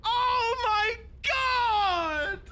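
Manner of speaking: loud, high, drawn-out yelp; real shock at the reveal; excited shout. Real, not acted. Streamer reacting to the reveal, loud drawn-out yelp.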